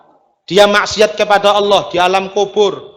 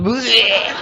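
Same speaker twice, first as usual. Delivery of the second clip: mock retch; 3 semitones higher than usual.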